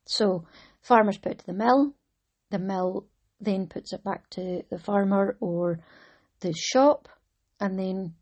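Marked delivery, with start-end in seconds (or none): none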